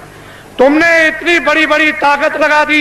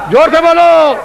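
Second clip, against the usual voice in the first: higher, louder rallying shout; loud call to crowd; 5 semitones higher, 4 dB louder than usual. Real, not acted. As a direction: rouse the crowd to shout back. Urging the crowd to answer.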